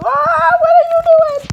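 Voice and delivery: high alarmed cry; shocked shriek. Real, not acted. Attacked by another player.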